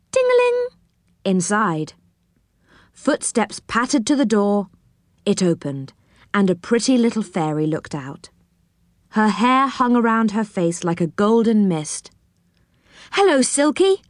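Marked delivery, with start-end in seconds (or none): 0.1-0.7 very high, tinkling ting-a-ling; bell imitation. A narrator's reading. Rick rings Silky's doorbell, high bell imitation. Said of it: "heard it go". 13.1-14.0 higher-pitched, lightly excited hello; cried greeting. A narrator's reading. Joe greets Silky at her door, mildly excited cry. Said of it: "cried Joe".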